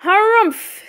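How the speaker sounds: high, loud harrumph; huffy. A narrator's reading. Fox walking away from spoilt game, huffy. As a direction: huff it out, pitched right up.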